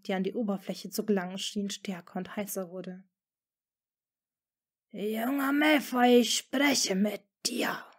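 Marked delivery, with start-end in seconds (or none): 4.9-7.8 slow, cold whispered hiss; the Dark Lord hissing at a faltering servant. A narrator's reading. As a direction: hiss the words, chilling and impatient. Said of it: "zischte er kalt".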